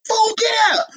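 high, squealing shout of joy; aunt shrieking as Janet appears; screaming aunt. Retold or imitated. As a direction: shriek it like a fan losing it.

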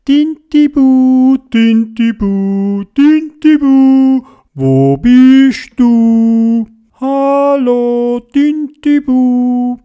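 loud, high, long-held calls; everyone calling out. A narrator's reading. All the fish calling for lost Tintibu, loud drawn-out shouts. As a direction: holler slowly, stretching the final vowels. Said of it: "dann haben alle gerufen".

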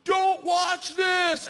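high, loud, stretched-out yell; shouted warning. Retold or imitated. Host warns the comic before jumping, drawn-out shout. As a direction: holler it slowly over the engines.

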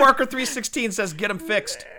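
words breaking into laughter; giggling through the punchline; laughing. Real, not acted.

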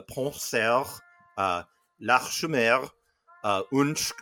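bury the place name in thick French; thicker French accent. Acted. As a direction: place names mangled in a heavier French accent. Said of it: "said it with this thicker accent".

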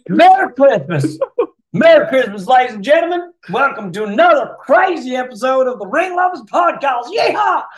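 loud, high-pitched hammed-up Southern holler; mock Southern. Host welcoming listeners to the Christmas episode, mock Southern shout. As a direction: whoop it up like a Southern cowboy host. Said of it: "I don't know why we're Southern".

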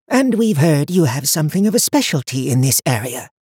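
put-on high, cold voice of an old seer; stern old woman. A narrator's reading. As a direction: speak down to him like a haughty elder. High Watcher voiced in story.

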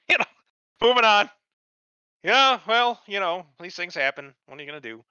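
high, laughing, breezy mock shrug; mocking Batman shrug. Batman mocked as he shrugs off a death, high breezy mock. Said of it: "Batman goes".

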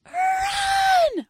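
high shrieked mock yell of the line; mock scream. Mimicking actress's line in movie.

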